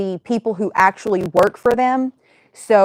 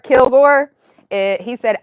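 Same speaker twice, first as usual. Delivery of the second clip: ordinary talk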